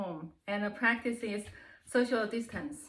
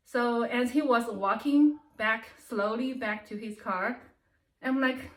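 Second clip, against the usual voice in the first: ordinary talk, nothing marked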